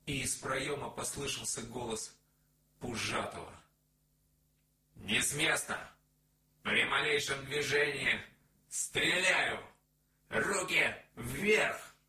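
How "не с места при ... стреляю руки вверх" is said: loud, slow barked orders; commanding shout. A narrator's reading. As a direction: bark the commands at gunpoint. Armed man bursts in shouting. (громкий приказной окрик)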